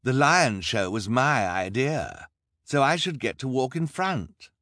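smug villain insisting on leading; Count Olaf boasting. A narrator's reading. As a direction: claim the credit grandly. Olaf voiced in story.

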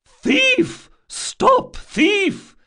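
very high, loud cry of alarm; strong holler. A narrator's reading. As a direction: holler for the whole street to hear. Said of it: "she hollered in a voice of surprising strength".

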